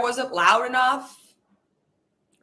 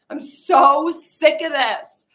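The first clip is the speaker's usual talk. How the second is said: fed-up rant; 4 semitones higher, 8 dB louder, slower than usual. Acted. Angry rant about the world.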